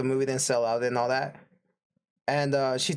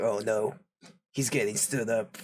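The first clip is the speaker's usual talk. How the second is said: pitying ticket guy; 6 semitones lower than usual. Retold or imitated. Ticket guy pities stood-up teen.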